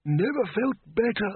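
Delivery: put-on high creaky old man's voice; chirpy doddering wizard; high thin old man, chipper; old Windle. A narrator's reading. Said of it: "said Windle".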